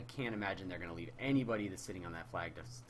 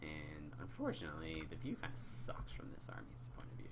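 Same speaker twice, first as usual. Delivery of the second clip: whispering; 5 semitones lower, 7 dB quieter than usual. Real, not acted. Muttering aside while moving cameras.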